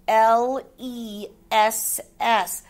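slow letter names in "L E S S", each set apart; spelled out. Shown as a demonstration. Teacher checking pupils' work, "L E S S" spelled slowly.

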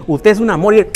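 normal talking voice

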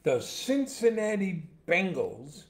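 loud, high-pitched matchup intro; hyped sports announcer. Real, not acted. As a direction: proclaim the team like a ringside announcer. Hyped announcement of the first game.

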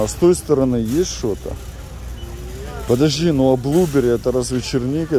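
normal talking voice

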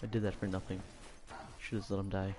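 low, soft dejected grumble; disappointed. Real, not acted. Player whose rescue was for nothing, disappointed. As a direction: let it sink, quiet and deflated. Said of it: "I'm so disappointed".

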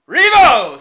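put-on loud, high drunken whoop; hollering like a sot; town drunk. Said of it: "says the drunkard".

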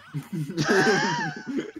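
high squealing burst of laughter; helpless shriek of laughter; cracking up at the fanfic. Real, not acted.

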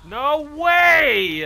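high loud disbelieving yell; shocked shout. Real, not acted. Shocked at outcome.

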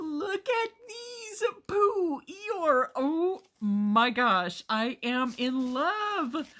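high, slow, loud squeals of delight with laughs; overjoyed squealing. Real, not acted. Unboxing a gift from her sister Tanya, squealing with delight.

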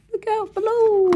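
high cartoon call from above; playful warning. Little character shouting a warning as it falls. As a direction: call down to someone below, playfully.

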